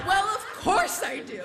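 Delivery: high, laughing, nearly shouted; eager yes. Retold or imitated. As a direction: blurt it out, delighted. Eager answer to grandma.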